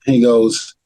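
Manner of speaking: ordinary delivery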